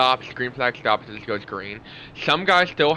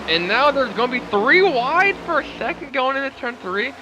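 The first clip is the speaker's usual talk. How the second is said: play-by-play; 6 semitones higher than usual. Acted. Live restart play-by-play.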